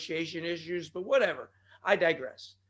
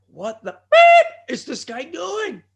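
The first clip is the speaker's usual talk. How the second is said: slow, much higher and louder with a laugh; exasperated yell; 9 semitones higher, 12 dB louder, slower than usual. Acted. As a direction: blurt it out in mock disbelief. Voicing a confused viewer.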